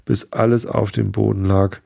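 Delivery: usual speaking voice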